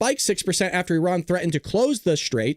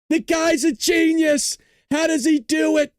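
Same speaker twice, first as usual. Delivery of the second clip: high, slow, loud mock praise; gushing awe; 9 semitones higher, 3 dB louder than usual. Real, not acted. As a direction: shout it like a starstruck fan. Sarcastic praise of Trump.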